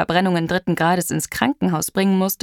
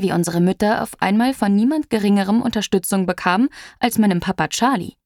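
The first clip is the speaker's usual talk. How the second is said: usual speaking voice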